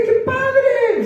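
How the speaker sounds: high, delighted exclamation; excited. Retold or imitated. Mother retells her delight to her son, excited. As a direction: squeal of delight.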